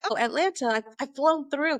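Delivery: light up lightly at a familiar place name; mock eager recognition. A well-traveled stranger hearing I'm from Atlanta, mock eager recognition.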